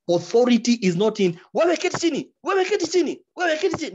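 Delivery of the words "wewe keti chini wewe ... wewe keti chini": high, shouted Swahili order; "wewe keti chini" yelled way up high; mock shouting judge. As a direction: bark the order like an angry judge. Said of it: "a judge who shouts".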